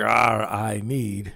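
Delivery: slow, drawn-out groan of a hungry stomach. Retold or imitated. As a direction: let the empty stomach moan its need slowly. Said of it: "your stomach's like".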